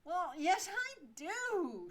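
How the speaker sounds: sulky actress pushing back; pouting. Acted. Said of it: "she pouts".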